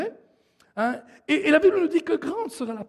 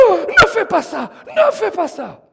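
higher, raised-voice plea of a pastor; acted urgent plea; 7 semitones higher, 5 dB louder than usual. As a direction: urge him against it, a touch hammed-up. Acts pastor urging the man. (supplication mimée, voix haute)

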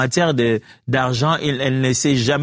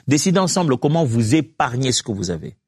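normal talking voice

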